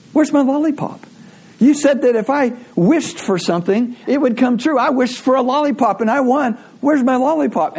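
put-on high-pitched child demanding her due; insistent little girl. Little girl argues with laughing mom, indignant and high. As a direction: imitate a kid pressing an indignant complaint.

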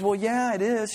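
high-pitched mock-upset woman; upset blonde. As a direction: play a hammed-up upset employee in a joke. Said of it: "she is again just crying and, and very upset".

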